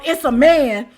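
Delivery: ordinary talk, nothing marked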